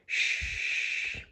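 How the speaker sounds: soft unvoiced shush; whisper. A narrator's reading. Tag hushing the squirrels for the sleeping cat, whispered. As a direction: hush them in a breathy whisper. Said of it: "whispers Tag".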